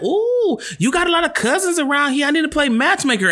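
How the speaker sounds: put-on very high gushing older lady voice; high imitated older lady. Stranger at party gushing to him, very high mock voice. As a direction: squeal it like an excited stranger.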